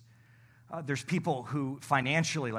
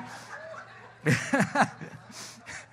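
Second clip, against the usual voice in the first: unvoiced laugh; breathy laugh; 8 semitones higher than usual. Real, not acted. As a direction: laugh at own joke. Laughs at own joke.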